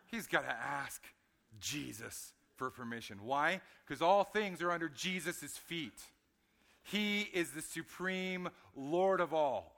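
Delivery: loud, rising preacher's cry; full-force preaching. Acted. Pastor proclaiming Jesus' supremacy to the congregation, loud and rising. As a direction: proclaim it to the whole church.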